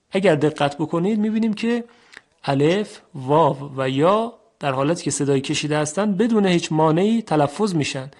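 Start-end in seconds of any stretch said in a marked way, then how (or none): none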